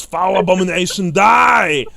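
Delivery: loud, high battle roar; roaring knight. Acted. Reiksguard knight to the creature, loud battle roar. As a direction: roar it, rising to a full-force final word. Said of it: "The Reiksguard roars".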